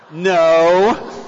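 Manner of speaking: a big shouted no; loud crowd-style no. Real, not acted. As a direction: shout the answer back across the room. Audience shouts back loudly.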